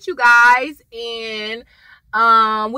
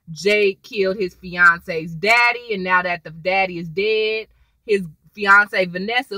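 ordinary talk

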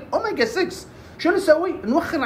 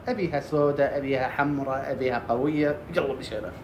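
much lower, softer put-on client voice; mock client voice; 9 semitones lower, 7 dB quieter than usual. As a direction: drop low and rattle off the wishes. Client lists hair wishes.

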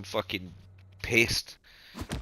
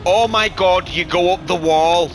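loud, high, slow disbelieving yell; amazed shout; 5 semitones higher, 10 dB louder, slower than usual. Real, not acted. Gamer watching a replay lap, yelling in amazement.

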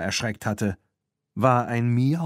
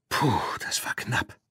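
breathless whisper, softer; panting whisper; 2 semitones higher, 4 dB quieter than usual. Acted. Tina hiding in the shed, panting in a whisper. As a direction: gasp it out under your breath. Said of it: "keuchte Tina".

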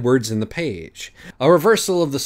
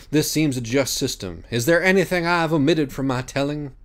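grandiose judge Minos; 7 semitones higher, 4 dB louder than usual. Acted. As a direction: speak like a lofty philosopher. Minos lays out voting.

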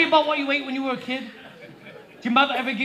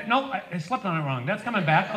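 normal talking voice

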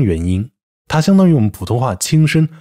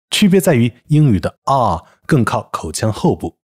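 ordinary talk

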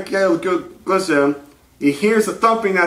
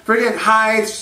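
usual speaking voice

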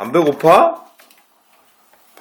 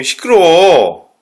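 drawn-out scolding; much slower than usual. Real, not acted. Scolding the noisy pet.

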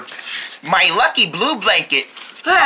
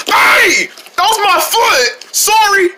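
startled yelp; 10 semitones higher, slower than usual. Acted. Foot stepped on.

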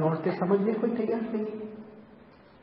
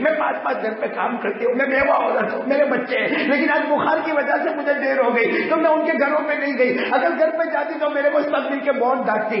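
rising plea; 8 semitones higher than usual. Retold or imitated.

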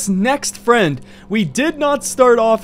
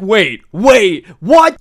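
stunned disbelief; 6 dB louder, much slower than usual. Acted. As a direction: act out a friend's shock, peaking on the last word. Friend stunned by gift of keys.

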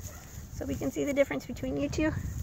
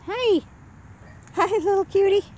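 puppy talk; 10 semitones higher than usual. Real, not acted. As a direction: coo at the puppies up high. Coaxing puppies, high-pitched.

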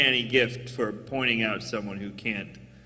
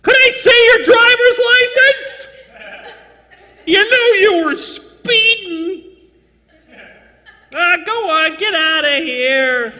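very high, loud, squeaky police officer; high-voiced cop; 9 dB louder than usual. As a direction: play a cop in a squeaky falsetto. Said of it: "it's the kind of voice".

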